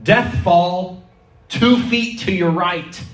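high, drawn-out warning call; shouted warning. Retold or imitated. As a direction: call it out as if to a climber behind. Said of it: "who would shout back to him".

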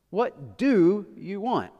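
slow question leaning hard on "do"; stress on DO. Shown as a demonstration. Pastor testing where the emphasis goes, stress on DO. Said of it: "where do you put the emphasis here".

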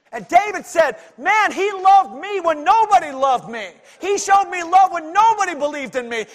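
shouting, high and loud, rousing the church; preaching at full force. Acted.

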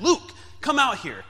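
high, loud and slow call across the house; mom calling out. Retold or imitated. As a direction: call the boy in like a mother summoning him. Mom calling him from afar.

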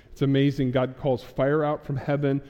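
normal talking voice

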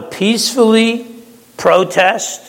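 "peacefully" lifted high and leaned on; stress on peacefully. Shown as a demonstration. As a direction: punch the word "peacefully". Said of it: "emphasis on the word peacefully".

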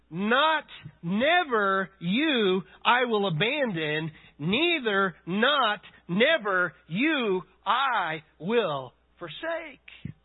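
slow, word by word, each negative weighed; slow literal reading. Shown as a demonstration. Preacher showing the congregation the literal Greek, slowly and deliberately. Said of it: "this literally reads".

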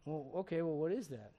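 normal talking voice

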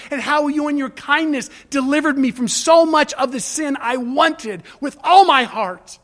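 loud, heartfelt prayer to God; praying aloud with rising force; fervent prayer. Shown as a demonstration. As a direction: pour it out to God, pressing forward. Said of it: "I hold the dialogue with God".

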